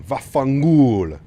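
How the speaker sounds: put-on loud, slow Italian accent curse. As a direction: spit it out like a hammed-up Italian. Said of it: "Tony is proper Italian".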